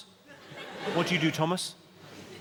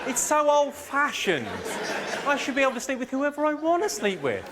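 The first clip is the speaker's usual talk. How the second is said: lightly voiced boyfriend, higher pitched; mock persuasive boyfriend; 7 semitones higher than usual. Boyfriend convincing her they need no relationship, mock persuasive. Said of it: "he's convinced you that".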